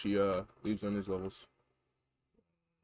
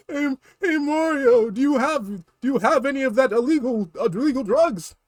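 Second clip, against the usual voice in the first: high, pleading little-brother voice with laughs; whiny Luigi; 14 semitones higher, 10 dB louder than usual. Acted. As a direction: squeal for Mario's help.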